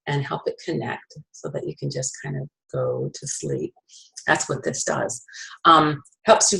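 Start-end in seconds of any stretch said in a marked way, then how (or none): 0.1-5.2 very soft, lower, trailing toward sleep; soothing hush. Shown as a demonstration. Explaining how the oil helps you sleep, soothing hush. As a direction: hush down as if lulling someone. Describing brain calming into sleep.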